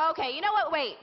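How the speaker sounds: ordinary talk, nothing marked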